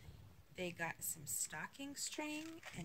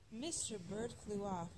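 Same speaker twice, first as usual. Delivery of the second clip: plain reading voice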